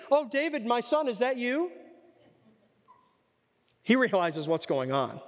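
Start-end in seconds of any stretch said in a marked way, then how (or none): 0.1-1.7 higher, mock-sweet embarrassed king; embarrassed King Saul. Saul caught wrong, sweetly calling David son. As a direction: fake fondness to cover being wrong. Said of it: "that he's embarrassed or wrong".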